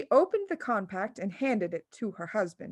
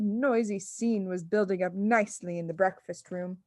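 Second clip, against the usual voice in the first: ordinary delivery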